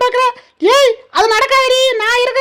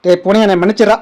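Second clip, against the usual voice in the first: deep animal voice. Acted. Animal voiceover comic skit.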